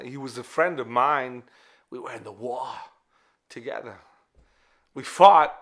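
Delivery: put-on solemn war veteran telling a boy, with loud bursts; Pulp Fiction watch speech. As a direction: play a grave old soldier addressing a child. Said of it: "It is the watch scene".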